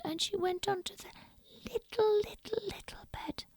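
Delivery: high, fading whisper; whispered, fading to nothing; tiny whisper. A narrator's reading. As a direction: shrink the voice down to the little bed. Hushed narration to tiny bed.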